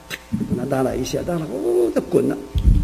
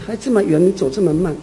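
ordinary delivery

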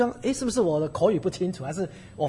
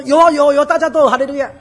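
loud, high mock reassurance; imitating the pastor; 8 semitones higher, 11 dB louder than usual. Preacher imitating the helping pastor's loud quick reply. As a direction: play the pastor brushing it off, bright and loud. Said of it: "他跟我说".

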